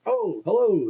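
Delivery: high put-on polite hello; overly polite drunk greeting; mock polite hello. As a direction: try hard to sound normal and sober. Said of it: "And I was like".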